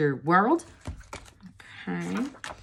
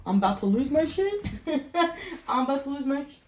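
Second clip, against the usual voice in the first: laughing; 8 semitones higher, 3 dB quieter, faster than usual. Real, not acted. Breaks up mid-reading.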